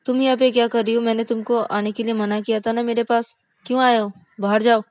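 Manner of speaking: loud, high furious dismissal; an angry wife yells at her husband to leave; angry shouting. Acted. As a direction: order him out at full volume. Angry wife ordering him out.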